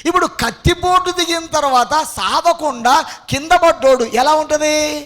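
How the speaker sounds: loud, high-pitched sermon delivery; full-force preaching. Real, not acted. Preacher tells congregation of the devil's fall, full-force preaching. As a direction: belt it out to the congregation.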